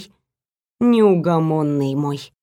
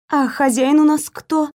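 higher, wary asking; cautious question; 6 semitones higher than usual. A narrator's reading. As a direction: ask warily, testing the ground. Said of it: "поинтересовалась осторожно". (осторожный вопрос)

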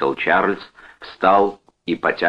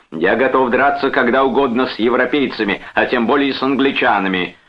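higher, heated bluster; roaring challenge; 7 semitones higher, 3 dB louder than usual. A narrator's reading. Brother challenges the foreigner, heatedly roaring. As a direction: keep the fighting talk raised. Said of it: "заревел Чарльз".

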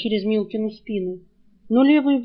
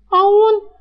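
high, loud indignant cry; sharp shocked outburst, voice jumping up; outraged gasp; 10 semitones higher, 8 dB louder than usual. Acted. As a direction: choke out the question in outrage. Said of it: "задохнулась от возмущения".

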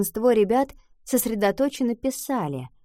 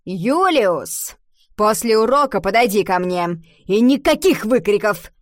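loud, cross reprimand; angry teacher; 6 dB louder than usual. A narrator's reading. Teacher reprimands Юлиус for shouting out, angry and loud. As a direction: scold him sharply, raising your voice. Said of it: "Госпожа Шлёзингер рассердилась".

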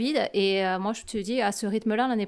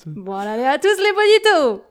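voix de pouf; 3 semitones higher, 7 dB louder than usual. Acted. Redoing the put-on greeting.

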